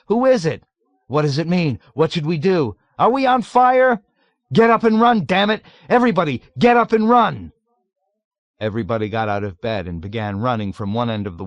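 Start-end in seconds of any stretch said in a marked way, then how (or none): 0.1-7.5 high, loud frantic shouts; panicked ranting. A narrator's reading. Patients in a ward in bedlam, shouting in panic. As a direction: yell as if the place is burning. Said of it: "began ranting incoherently".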